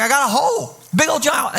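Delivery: high-pitched mock alarm; freaking out. Pastor retelling his panicked call to a friend, mock alarm. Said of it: "I am freaking out".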